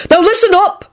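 high, commanding call for attention; shouted. A narrator's reading. Neville to the crowded hall, shouted. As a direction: shout down the whole room. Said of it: "shouted Neville".

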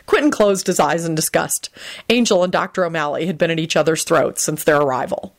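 ordinary delivery

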